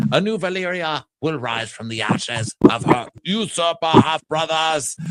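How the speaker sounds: put-on rousing speech; mock proclamation. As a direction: declaim it like a herald, slowing at the end. Acting out Daemon's grand proclamation.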